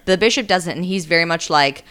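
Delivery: normal talking voice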